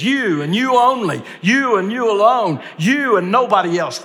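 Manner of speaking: high, loud stress on each "you"; emphatic. Retold or imitated. Preacher voicing Jesus singling out each disciple, emphatic. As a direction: hammer every "you", pitched well up. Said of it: "that's the emphatic way he asked it".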